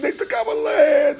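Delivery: high mocking whine; mock crying. Mimicking people crying over land, mock whining. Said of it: "they're crying".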